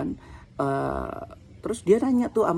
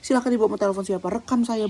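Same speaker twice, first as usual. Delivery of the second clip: fast, higher-pitched dare; retold angry challenge; 6 semitones higher than usual. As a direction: challenge the clerk, reliving the anger. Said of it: "Saya emosi banget".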